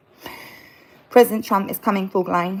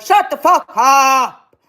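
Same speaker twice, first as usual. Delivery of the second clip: slow, loud yell with a higher pitch; retold yell; 7 semitones higher, 9 dB louder, much slower than usual. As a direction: bark it back at him, drawn out and hammed up. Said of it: "And I was like".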